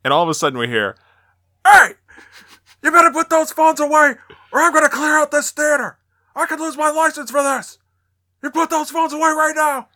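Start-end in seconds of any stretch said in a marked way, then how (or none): 1.6-9.9 high, strained mock yelling from the projection booth; outraged projectionist impression, pitched way up; imitated yell. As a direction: yell a warning at a crowd in a put-on high voice. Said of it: "the guy yells at us".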